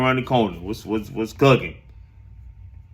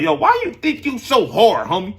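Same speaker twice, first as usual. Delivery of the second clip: mock interviewer; 7 semitones higher than usual. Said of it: "get him on the interview".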